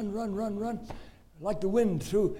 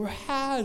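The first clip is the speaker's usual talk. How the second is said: high mock wail "wah"; imitated child's wail; 7 semitones higher than usual. Boy with a nail through his foot, mock wailing. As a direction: let out a childish cry. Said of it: "All of them screaming".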